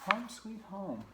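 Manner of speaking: high, singsong mouse voice; little mouse. Acted. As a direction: pitch it way up as a contented mouse.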